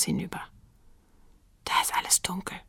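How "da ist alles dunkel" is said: hushed, breath-only whisper. A narrator's reading. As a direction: whisper it so nobody in the house hears. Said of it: "flüsterte Willi". (leise geflüstert)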